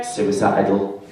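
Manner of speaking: low, slow, flat reply; deadpan. Acted. Answering the chirpy cashier in a low, slow deadpan. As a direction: drop it dead and slow.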